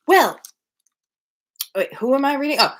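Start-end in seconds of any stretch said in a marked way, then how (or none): none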